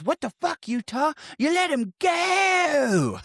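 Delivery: high-pitched mock Australian shout, last word dragged out; bad Australian accent. Podcaster mocking a film cop scolding the agent, bad Australian accent. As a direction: ham up a terrible Australian cop yelling. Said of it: "trying to do an Australian accent".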